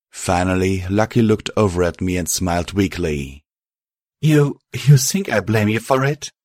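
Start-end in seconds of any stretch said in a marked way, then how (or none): 4.2-6.3 higher, halting character voice; gentle disbelief. A narrator's reading. Lucky answering Star's guilt, taken aback. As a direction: stumble in, lightly incredulous.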